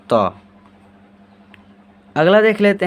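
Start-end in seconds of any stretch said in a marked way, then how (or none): 0.1-0.3 तो said short and clear; letter sound demo. Shown as a demonstration. Teacher modelling तो for students, short and clear. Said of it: "इसका उच्चारण क्या हो जाएगा".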